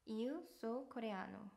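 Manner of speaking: very slow "Eu soy coreano"; slow demo pronunciation. Shown as a demonstration. Teacher showing travellers how to introduce themselves, very slow. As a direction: lay out each word slowly. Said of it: "라고 하시면 돼요".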